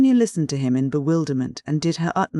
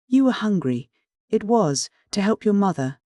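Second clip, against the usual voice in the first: higher-pitched, urgent outburst; sudden cry; 6 semitones higher than usual. A narrator's reading. Sonia suddenly offering him an excuse, crying out. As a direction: cry out a hopeful explanation. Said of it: "but suddenly she cried".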